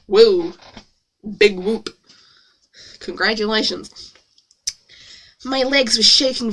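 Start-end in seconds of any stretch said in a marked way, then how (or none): 0.1-4.1 low, unimpressed mock congratulation; sarcastic cheer. Real, not acted. Reader mocking the getting-out-of-bed line, deadpan sarcastic. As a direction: celebrate with no enthusiasm at all.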